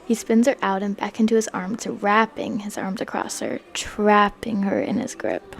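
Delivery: ordinary talk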